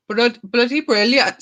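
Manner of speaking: attempted Welsh lilt on "bloody brilliant", coming out Indian; failed Welsh accent. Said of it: "See, it sounds Indian".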